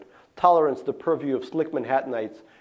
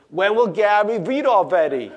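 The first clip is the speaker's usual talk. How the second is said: louder and higher, mock kids' shout; kids shouting; 7 semitones higher, 6 dB louder than usual. Classmates shouting for Gary's novel time, louder and higher. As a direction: call out impatiently like schoolkids. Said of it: "they will shout out".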